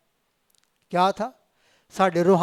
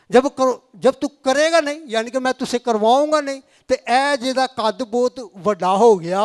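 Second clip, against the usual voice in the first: a touch louder and higher, insistent; lightly raised preaching; 4 semitones higher, 6 dB louder than usual. Acted. Pastor relays God's reply to the congregation, a bit louder. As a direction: press the point home to the hearers.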